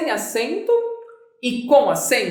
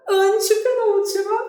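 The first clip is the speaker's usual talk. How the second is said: high emphasis; 9 semitones higher than usual. Shown as a demonstration. Counting syllables, stressed one.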